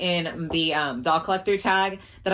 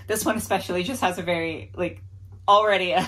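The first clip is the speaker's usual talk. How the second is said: ordinary talk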